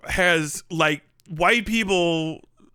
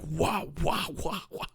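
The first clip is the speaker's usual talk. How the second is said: soft, pulsing video-game wahs; laser charge noise; 3 semitones lower, 9 dB quieter than usual. Retold or imitated. Imitating Mega Man's level three laser, pulsing sound. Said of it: "X three that goes like".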